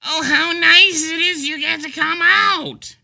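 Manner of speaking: very high, loud, slow condescending coo; mocking sweet stranger. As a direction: gush at him like a patronizing passerby. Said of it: "imitates strangers".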